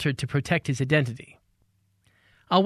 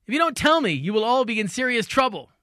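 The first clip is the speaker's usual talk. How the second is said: angry shout; 8 semitones higher than usual. Acted. Angry man threatening the class.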